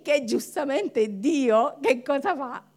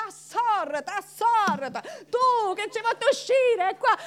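crowd shouting at Moses; 7 semitones higher than usual. Retold or imitated. The Israelites yelling at Moses, angry high shout. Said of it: "Qui, tutti a gridare".

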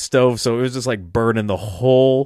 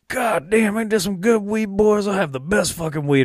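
much higher put-on uncle voice, bragging; imitating Uncle Bill; 9 semitones higher than usual. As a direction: ham up the stoned uncle showing off his weed. Stoned uncle bragging about weed.

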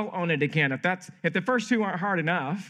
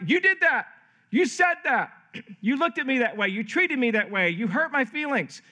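higher-pitched mock blame, hard opening jabs; finger-pointing accusation; 5 semitones higher than usual. Someone blaming the other in a conflict, accusing. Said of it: "we point towards the other person".

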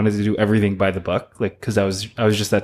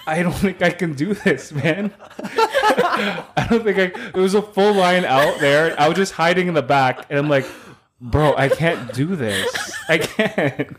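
higher, giggly mock despair; laughing; 5 semitones higher, slower than usual. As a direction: laugh through the helpless plea. Laughing while quoting himself panicking.

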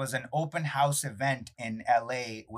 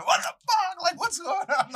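high, laughing mock yell; hammed-up gamer rage through laughter; mock screaming at a game; 4 dB louder than usual. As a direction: yell at the screen, half laughing. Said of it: "just screaming".

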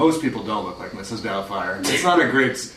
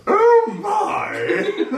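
man doing a woman's voice. Retold or imitated. Reacting in the doll voice.

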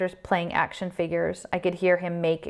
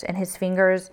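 ordinary delivery, nothing marked

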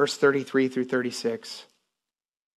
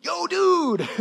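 much higher put-on voice of a tiny laid-back guy; imitating Pancho Juarez. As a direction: act like a small excited Mexican pastor, high. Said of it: "I'm just acting like him".

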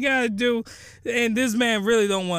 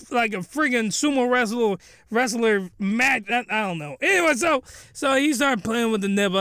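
ordinary talk

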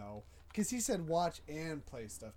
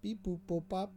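lightly voiced computer beeps; Mac impression; 3 dB quieter than usual. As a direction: beep like a computer. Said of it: "here's my impression of a Mac".

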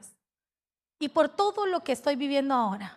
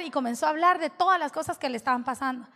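ordinary talk

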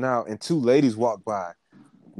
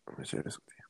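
whispering; 17 dB quieter, much faster than usual. Real, not acted. Whispering aside while sharing screen.

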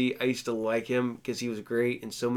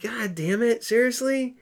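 disbelief pitched way up, high and incredulous; exasperated rising groan; 10 semitones higher than usual. Retold or imitated. Fan finding out a favorite actor is cursed, exasperated. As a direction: throw up your hands, voice climbing high. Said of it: "it's like".

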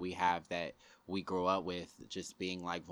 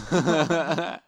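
big laugh, much higher and louder; loud burst of laughter; 10 semitones higher, 12 dB louder than usual. Real, not acted. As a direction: crack up out loud. Bursts laughing at own joke.

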